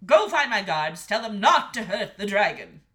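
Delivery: loud commanding king; shouting Henrik. A narrator's reading. King sending the boy to his guards, shouting orders. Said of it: "shouted Henrik".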